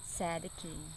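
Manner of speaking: gentle, soft storybook reading; story narration. A narrator's reading. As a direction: read it like a bedtime story for kids. Reading Bible story to children.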